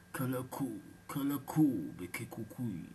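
slow chanted magic words, odd timbre; strange incantation. A narrator's reading. Genie casting a spell on the goat, chanted. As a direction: intone it like a spell. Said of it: "énonça une phrase bizarre".

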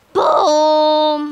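loud triumphant boom shout. Real, not acted. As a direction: yell it as the blast hits. Explosion callout.

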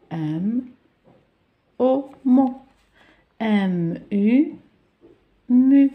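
letters held apart, then joined as "mo" and "mu"; syllable sounding-out. Shown as a demonstration. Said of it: "ونقرأ في نفس الوقت".

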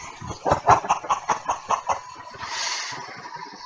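villain laugh. Acted. Villain voice laughs after monologue.

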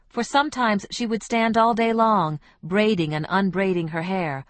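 ordinary delivery

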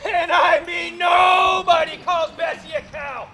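furious yell. Acted. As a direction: scream it out in cartoon rage. Wife insulted, hammy rage.

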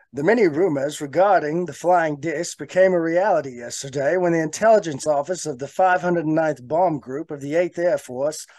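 put-on old-time newsreel announcer reading a bulletin; vintage news anchor voice, carried in one long breath; 1940s news anchor. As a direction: read it like a forties radio newsman. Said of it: "in my best nineteen forties, uh, news anchor voice".

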